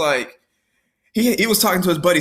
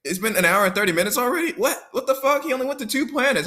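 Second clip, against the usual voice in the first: shocked friend; 5 semitones higher, faster than usual. Retold or imitated. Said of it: "and he's like".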